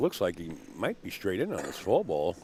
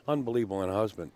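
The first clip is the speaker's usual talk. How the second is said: normal talking voice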